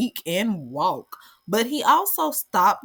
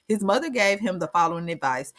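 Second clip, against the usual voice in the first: ordinary talk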